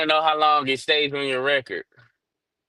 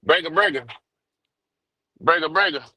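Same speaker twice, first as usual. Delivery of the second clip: over walkie talkie. Real, not acted. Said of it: "I low signal".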